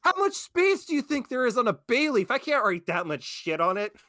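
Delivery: high, loud comic indignation; exasperated outburst. Real, not acted. Co-host objecting to the bay leaf instructions, exasperated. Outraged at the spell's impossible instructions.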